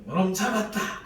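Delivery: bright, loud gotcha; playful catch. Retold or imitated. Father catching a child, loud playful gotcha. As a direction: pounce on it with glee. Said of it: "그런 느낌 있잖아요".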